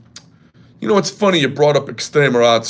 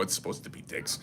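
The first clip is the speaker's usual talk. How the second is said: whisper; 7 semitones lower, 19 dB quieter, faster than usual. Real, not acted. Whispered aside about hot dogs.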